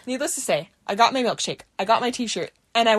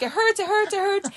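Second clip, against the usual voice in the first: whimpering; 8 semitones higher than usual. Retold or imitated. Sobbing teen during the shot.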